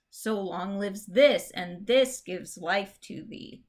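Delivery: "this" hit in both places, slow and deliberate; stress on this. Shown as a demonstration. Teacher reading a Shakespeare line aloud, stressing this. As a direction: lean on each "this" as you read. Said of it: "it's given the stress".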